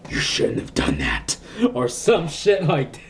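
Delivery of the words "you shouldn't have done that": low, whispered spirit threat; creepy whispering ghost; ghostly whisper. Retold or imitated. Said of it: "some spirit was gonna come out of the wall like".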